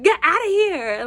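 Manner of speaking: high, loud mock yell; retold shout. Attacker driving off the runner, high retold shout. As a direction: yell it as the attacker would, in retelling.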